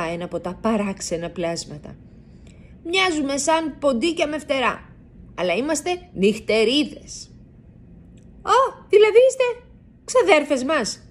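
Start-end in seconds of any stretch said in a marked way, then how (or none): 2.8-7.3 a lightly voiced bat, pitched higher; a small night creature explaining itself kindly. A narrator's reading. Bat character speaks. 8.4-11.0 high, louder little mouse asking in delight; a young mouse thrilled to meet cousins; surprised question. A narrator's reading. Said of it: "ρωτάει ο Λάκη".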